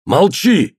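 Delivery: high-pitched command for silence; sharp order. A narrator's reading. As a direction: cut the speaker off abruptly. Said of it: "сказал Иодан".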